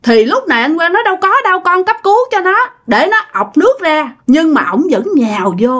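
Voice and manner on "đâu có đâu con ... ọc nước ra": high, urgent denial; acted protest. Accused Quang explaining he was saving the girl, high urgent protest. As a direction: act out a man hastily defending himself.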